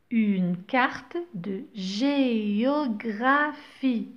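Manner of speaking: géographie drawn out, syllable by syllable; slow model reading. Shown as a demonstration. Teacher to pupils, reading géographie very slowly. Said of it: "Le E est toujours muet".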